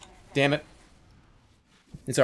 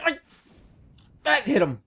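usual speaking voice

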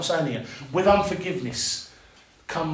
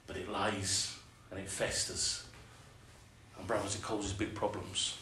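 hushed warning; 8 semitones lower, 16 dB quieter than usual. Real, not acted. Preacher drops voice.

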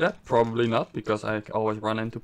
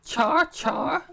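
high squeaky creature call; Charmander cry; 11 semitones higher than usual. Pretend. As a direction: chirp like the little fire lizard.

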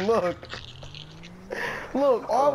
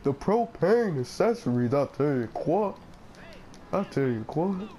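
Hank Hill voice; 4 semitones lower, 3 dB quieter than usual. Shown as a demonstration. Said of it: "All right, I'm gonna try".

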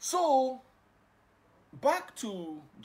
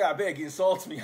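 mock shout; 2 semitones higher, 9 dB louder than usual. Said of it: "Someone say".

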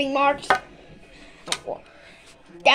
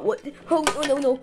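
usual speaking voice

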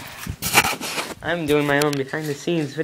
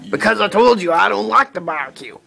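man voice; 4 semitones higher, 3 dB louder than usual. Shown as a demonstration. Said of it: "like talking all deep".

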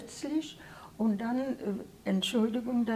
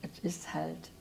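normal talking voice

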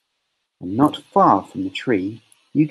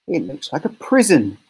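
plain reading voice

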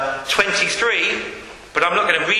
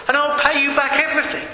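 higher, hurried hint of begging; mild pleading; 6 semitones higher, faster than usual. Servant begging his master for time, a touch of pleading. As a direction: plead quickly, lightly. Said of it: "he begged".

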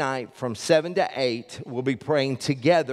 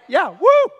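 loud whoop, much higher; mock cheer; 11 dB louder than usual. Pastor teasing the congregation with a loud, high mock whoop. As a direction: play up a joking cheer. Cheering about not hearing sermon.

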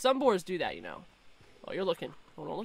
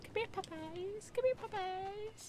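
coaxing pet call; 6 semitones higher, 15 dB quieter than usual. Real, not acted. Calling puppies in game.

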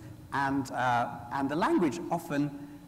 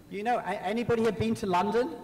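normal talking voice

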